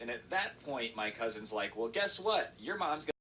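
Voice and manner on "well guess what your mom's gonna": high-pitched pointed retort; mock gotcha. Cousin tells kids their mom will pay, mock gotcha. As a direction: throw it back at them, voice raised in pitch.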